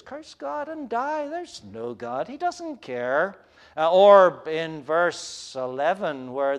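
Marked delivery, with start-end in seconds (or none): 0.0-3.4 cynical taunt. Acted. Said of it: "voice of Job's comforters".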